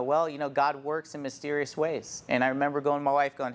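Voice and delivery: usual speaking voice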